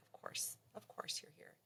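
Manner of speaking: breathless whispered realization; a whispered aside to yourself; hushed inner thought. Retold or imitated. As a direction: mutter it under your breath in dawning dread. Realizing dad is there, inner thought.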